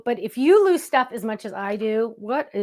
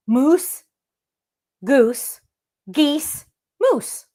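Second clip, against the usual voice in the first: mock-puzzled list "Moose, goose, geese, moose"; listing the plurals; 4 dB louder, much slower than usual. Shown as a demonstration. Host mocking English plurals, puzzled listing. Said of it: "Makes no sense".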